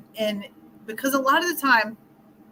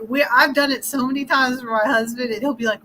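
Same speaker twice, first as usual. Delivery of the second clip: normal talking voice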